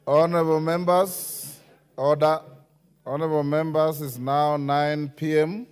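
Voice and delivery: loud call for order over the chamber. Real, not acted. Speaker calling the House to order.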